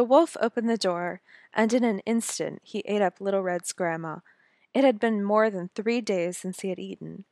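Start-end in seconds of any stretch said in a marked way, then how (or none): none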